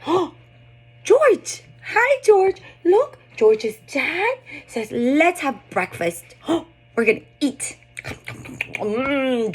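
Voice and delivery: excited storytime for a little one; animated storytelling. Shown as a demonstration. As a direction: sell every George to a toddler. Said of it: "emphasizing on some target vocabulary".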